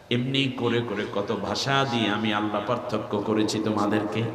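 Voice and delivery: usual speaking voice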